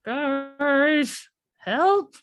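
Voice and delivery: calling for help. Acted. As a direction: call down to friends, laughing. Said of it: "calling down to them".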